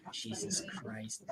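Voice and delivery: breathy frustrated whisper, slow; whispered under breath. Real, not acted. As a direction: mutter it to yourself, barely audible. Muttered aside while fixing graphics.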